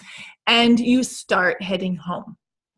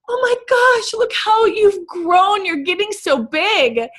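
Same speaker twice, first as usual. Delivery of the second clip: much higher, gushing auntie voice; 10 semitones higher, 4 dB louder than usual. Retold or imitated. Aunt coos over the kids, gushing and much higher. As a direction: coo over the grandkids like a doting aunt. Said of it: "your aunt looks at them and says".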